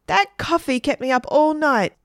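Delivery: high-pitched exasperated complaint; very angry. A narrator's reading. Dad to the children after a sleepless night, angry complaint. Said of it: "Dad was very angry".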